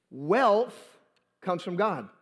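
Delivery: "Wealth" set apart by a pause; pause for emphasis. Real, not acted. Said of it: "to pause for emphasis".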